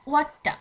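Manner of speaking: ওয়াট্টা said as Swedish "wat-ta", a long vowel then a held t; Swedish pronunciation demo. Shown as a demonstration. Teacher shows students the Swedish word for eight, careful pronunciation. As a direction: model ওয়াট্টা with the doubled t. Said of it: "এইটকে সুইডিশে বলা হয়".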